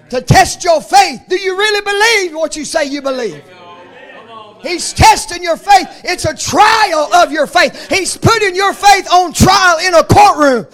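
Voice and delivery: loud, ringing pulpit cadence, each phrase hammered home; full-force preaching. Acted. Preacher to his congregation, full-force shouted preaching. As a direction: preach it like a revival climax. Preacher building on faith trials.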